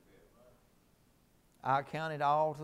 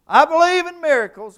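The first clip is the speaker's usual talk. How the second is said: high, loud, held preacher's cry; preaching shout; 21 dB louder than usual. Acted. Preacher crying out to the church, high and loud. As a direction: belt it out high and long.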